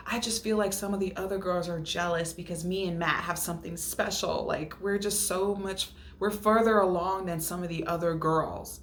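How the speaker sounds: ordinary talk, nothing marked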